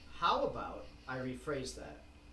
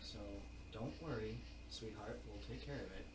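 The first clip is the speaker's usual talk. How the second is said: softer tender voice; softer tender reassurance; 6 semitones lower, 12 dB quieter than usual. Acted. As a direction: comfort her gently. GM reassuring as Mister Green.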